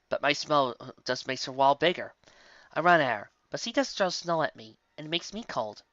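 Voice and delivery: ordinary delivery, nothing marked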